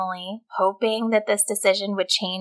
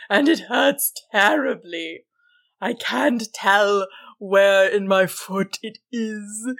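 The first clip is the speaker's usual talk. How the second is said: slow, wailing monster voice; distressed troll; 4 semitones higher, slower than usual. A narrator's reading. Troll complains to Susan of pain, crying in distress. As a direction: moan each word long and miserably. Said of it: "the troll cried in distress".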